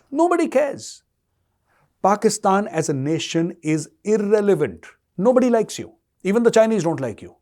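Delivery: high, loud contempt; scornful outburst. Real, not acted. Commentator taunting Pakistan directly, high scornful outburst. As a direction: spit the dismissal out, pitch climbing.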